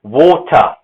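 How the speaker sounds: British "water" with an O vowel and crisp T; water in British English, no final R; British pronunciation. Shown as a demonstration. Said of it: "they pronounce it".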